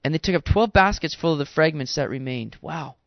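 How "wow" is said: normal talking voice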